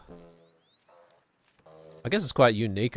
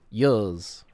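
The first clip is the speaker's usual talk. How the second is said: New Zealand accent, years said with a clipped vowel. Shown as a demonstration. Asked to say it, New Zealand accent. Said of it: "Say years".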